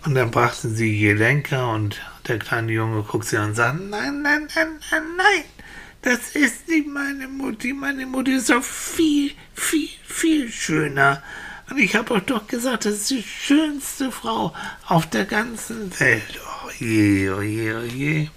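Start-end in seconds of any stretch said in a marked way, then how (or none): 3.8-16.4 put-on insistent little boy. Boy protests again. 16.6-18.3 low, worried lament; dismayed villagers. Acted. Villagers at a loss.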